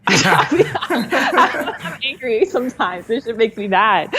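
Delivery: high-pitched and slow through laughter; real amusement breaking into the words; laughing admission. Real, not acted. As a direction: laugh while owning up to it. Laughs while admitting her anger.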